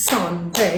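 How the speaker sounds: "সানডে" split as sun-day; syllable by syllable. Shown as a demonstration. Teacher dividing syllables for students, syllable by syllable. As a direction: clap out "সানডে" in two separate beats. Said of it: "আমরা syllable ভাগ করতে পারি".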